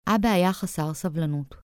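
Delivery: usual speaking voice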